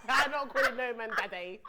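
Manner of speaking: loud, bursting with laughter; laughing refusal. Real, not acted. Guest refusing to call a man daddy, laughing loudly. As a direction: protest through a big laugh.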